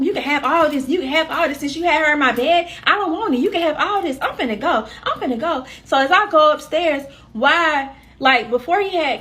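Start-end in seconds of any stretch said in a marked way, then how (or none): 0.0-5.7 louder, higher retold quarrel; fed up. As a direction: fire it off, fed up and done. Retold quarrel with cheating partner.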